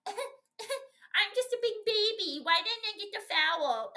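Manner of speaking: hammed-up crybaby imitation of a complaining star; mock crybaby. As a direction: whine like a sulking big baby. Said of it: "Here he goes again".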